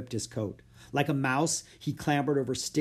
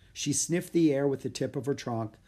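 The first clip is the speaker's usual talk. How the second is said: ordinary delivery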